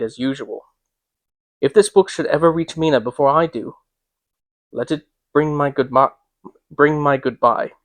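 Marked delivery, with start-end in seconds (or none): none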